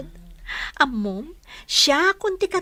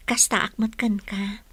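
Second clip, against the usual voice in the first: whispered confession; 4 semitones lower than usual. Acted. Sunshine confessing love back.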